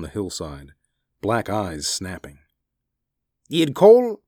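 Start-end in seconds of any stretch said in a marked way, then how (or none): 3.5-4.2 very high, loud urgent question; Shirkov breaking off. A narrator's reading. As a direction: cut off a high-pitched, anxious question. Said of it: "he broke off".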